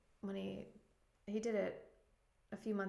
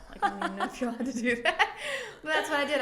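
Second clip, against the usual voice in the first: real laughter breaking through, high and loud; laughing admission; 7 semitones higher, 8 dB louder, faster than usual. Real, not acted. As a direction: confess it through a quick laugh. Laughs admitting flushing her pills.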